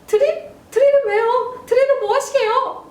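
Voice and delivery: very high, startled questions; alarmed mom role. Acted. Mother hears dad demand a drill, very high alarmed questioning. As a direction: squeal in acted alarm as the mother. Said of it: "자, 엄마".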